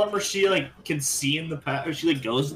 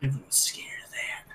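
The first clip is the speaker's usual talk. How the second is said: whispering; 3 dB quieter than usual. Real, not acted. Unvoiced aside.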